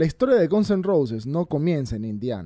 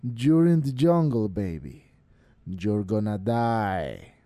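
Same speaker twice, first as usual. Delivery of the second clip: deep echoing underworld god; 6 semitones lower, much slower than usual. A narrator's reading. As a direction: drop low and slow, like a ghoul. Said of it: "con voz de ultratumba".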